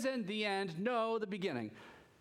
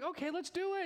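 cheerful dad; 11 semitones higher than usual. Retold or imitated. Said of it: "I'm like".

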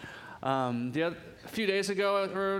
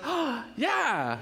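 put-on high, squealing little-girl cheer; delighted toddler; 7 semitones higher than usual. Daughter cheering over two cookie halves, high and delighted. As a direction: squeal it like a thrilled two-year-old. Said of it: "And she goes".

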